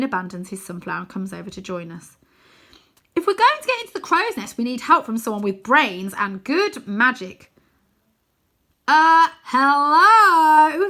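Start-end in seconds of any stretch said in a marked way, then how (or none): 3.1-7.5 a hint of a keen little wizard; lightly voiced eager Wynne. A narrator's reading. Wynne proposes getting help. 8.9-10.9 high, loud, very slow and stretched; offended, pointing at himself; indignant uh hello. A narrator's reading.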